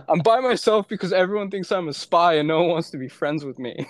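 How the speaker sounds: telling the police why he travels alone, through laughter; laughing through it. Retold or imitated. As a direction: laugh while admitting the sad reason. Laughing retelling of his answer.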